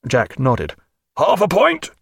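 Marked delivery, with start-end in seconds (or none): 1.2-1.9 raised order into the tube; call down the pipe. A narrator's reading. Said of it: "he called down the pipe".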